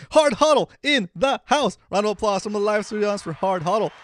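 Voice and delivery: loud, high mock announcer shout; hype announcer. Host hyping up an imagined live studio audience, announcer shout. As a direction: whoop up a studio crowd, stretching the words.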